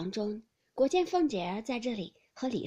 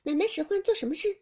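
fast, higher, louder eager maid; smiling eager question; 7 semitones higher, 5 dB louder, faster than usual. A narrator's reading. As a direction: rush up keen to serve. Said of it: "对着笑问".

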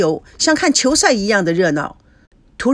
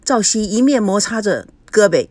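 ordinary delivery, nothing marked

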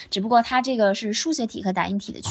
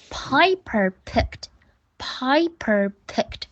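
Piper picked repeated slowly and clearly; slow drill; 2 semitones higher, much slower than usual. Shown as a demonstration. Teacher drilling Piper picked for pupils, slowly. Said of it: "我们练这个啊".

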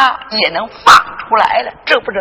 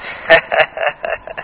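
breathy pleased laugh; old man chuckle; 6 semitones lower, 3 dB quieter than usual. Acted. Grandfather to grandsons, pleased chuckle. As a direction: chuckle with delight.

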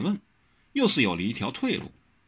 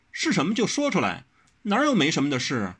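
higher, short-tempered; irritated; 7 semitones higher than usual. A narrator's reading. Wen Rou losing patience with Wang Xiaoshi. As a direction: snap at him impatiently. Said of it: "温柔没好气的道".

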